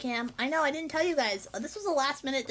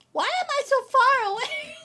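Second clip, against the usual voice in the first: surprised whine; 12 semitones higher, 7 dB louder than usual. Real, not acted. Startled by camera framing.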